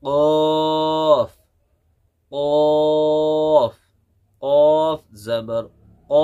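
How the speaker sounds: कॉफ stretched very long from the root of the tongue; tajweed letter demo. Shown as a demonstration. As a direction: hold कॉफ long, then a crisp क़. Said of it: "पढ़ने का तरीका क्या रहेगा?".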